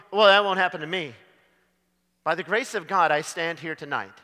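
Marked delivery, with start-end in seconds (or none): 0.1-1.1 smug denial. Retold or imitated.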